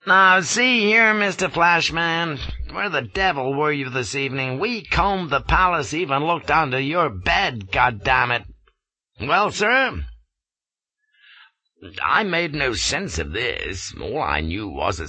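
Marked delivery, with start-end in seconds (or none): 0.1-10.0 scowling American colonel. A narrator's reading. Colonel scowling down at the shivering Flashman, angry. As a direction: bark it down at him like an angry headmaster. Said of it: "stood scowling down at me like a headmaster".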